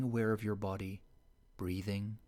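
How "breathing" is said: ordinary delivery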